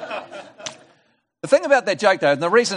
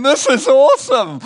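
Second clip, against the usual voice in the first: higher, louder and slow, savouring it; delighted shark; 8 semitones higher, 7 dB louder than usual. Acted. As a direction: relish the new power.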